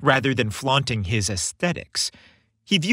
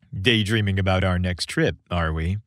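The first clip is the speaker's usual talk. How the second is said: playful quip; 6 semitones lower than usual. A narrator's reading. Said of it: "Flashing a playful smirk, Chris quipped".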